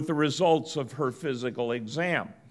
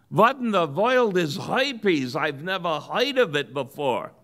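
put-on wife Esther in a higher Yiddish accent, a touch alarmed; 3 semitones higher than usual. Joke teller voices Esther in Yiddish accent. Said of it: "I can do the Yiddish accent".

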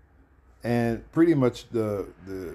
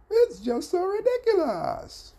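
high, drawn-out mock Cuban accent; 5 dB louder, slower than usual. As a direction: belt out a sitcom catchphrase in a put-on Cuban accent, slow and high. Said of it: "so-called Cuban accent".

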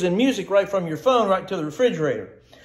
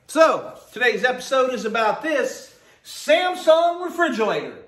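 ordinary talk

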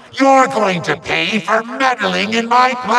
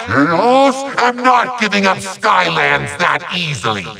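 normal talking voice

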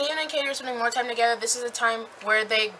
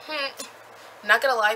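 ordinary delivery

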